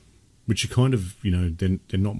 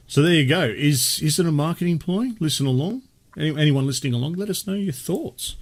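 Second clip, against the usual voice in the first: upbeat host; 7 semitones higher than usual. Acted. Addressing listeners.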